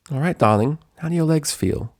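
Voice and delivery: a touch of tender lady; caring Rarity. Acted. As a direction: ask kindly. Rarity asking gently.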